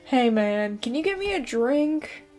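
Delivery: usual speaking voice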